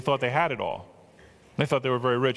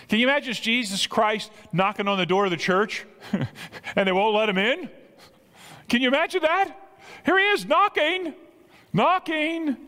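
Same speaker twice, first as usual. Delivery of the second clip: high-pitched, climbing amazement; amazed preaching; 11 semitones higher, 3 dB louder than usual. Real, not acted. Preacher marvels to congregation at Christ knocking, amazed and climbing. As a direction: marvel at it, pitch rising as you go.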